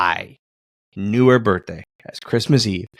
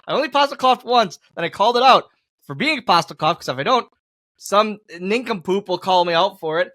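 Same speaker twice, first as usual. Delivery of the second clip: ordinary talk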